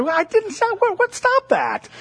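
high, loud, flustered confusion; baffled dad. Retold or imitated. Father answers his son's accusation, high and flustered. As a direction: sputter like a dad caught off guard. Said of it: "And I'm on the other side".

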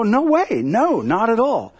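adamant denial. Retold or imitated. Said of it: "adamantly denies".

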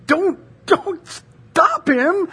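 high, slow, pleading Jesus. Acted. Jesus voiced in scripture reading.

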